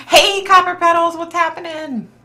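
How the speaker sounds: high, loud, singsong hello; excited greeting. Real, not acted. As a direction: call out brightly to a new arrival. Calls hello to arriving viewer.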